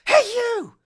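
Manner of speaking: high, loud shouted call. Retold or imitated. Host imitating Mace's shout from the fight scene, high and loud. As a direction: shout it out to grab attention. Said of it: "And then you say".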